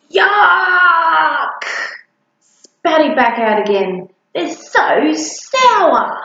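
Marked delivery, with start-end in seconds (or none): 0.1-1.6 loud, high spitting-out yuck; disgusted yuck. Acted. Spitting out food. 4.3-6.2 higher, very slow sour-faced whine; disgusted monster child. Acted.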